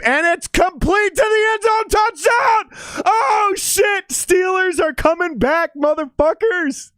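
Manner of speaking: real elated yelling at the screen; excited touchdown shout. Real, not acted. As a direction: roar it out as the touchdown lands. Touchdown called live on stream.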